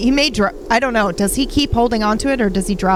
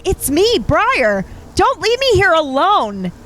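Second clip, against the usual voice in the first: higher, louder plea; yelling; 9 semitones higher, 3 dB louder than usual. Acted. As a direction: yell it from the monster's face. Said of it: "that face just be, like, yelling".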